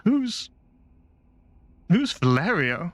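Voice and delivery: high, drawn-out puzzled ask; slowly, as if from a trance; dazed question. Acted. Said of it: "just goes".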